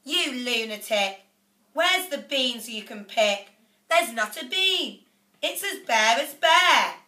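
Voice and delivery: put-on mother telling the boy off; scornful scolding. A narrator's reading. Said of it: "The mother said".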